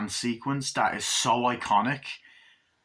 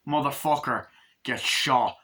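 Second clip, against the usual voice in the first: ordinary talk, nothing marked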